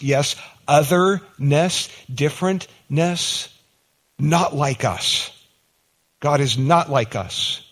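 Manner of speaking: slow, deliberate otherness, differentness; slow emphasis. Real, not acted. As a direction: lean on each word slowly. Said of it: "slow emphasis on otherness".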